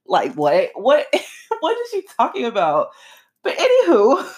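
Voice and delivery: high, laughing disbelief. Real, not acted. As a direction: crack up over how absurd it is. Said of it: "that made me laugh".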